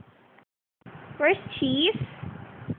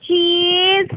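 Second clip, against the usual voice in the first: loud drawn-out call; calling a name across the house; 3 semitones higher, 7 dB louder than usual. Real, not acted. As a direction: call out to Cheese loudly. Calling out for Cheese.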